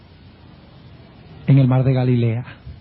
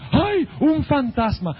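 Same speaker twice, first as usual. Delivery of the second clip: high, drawn-out mock cry of alarm; acted fear; 8 semitones higher, slower than usual. Disciples spotting a figure on the water, frightened cry. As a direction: cry out in mild stage fright. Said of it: "se llenaron de temor".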